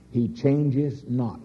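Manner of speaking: ordinary delivery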